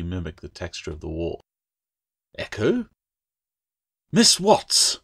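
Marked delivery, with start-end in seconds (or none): 2.4-2.9 high, rising surprised call; puzzled call. Acted. Claire calls her vanished friend. 4.1-5.0 high, loud sharp call. A narrator's reading. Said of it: "Mistress Latch's voice echoed".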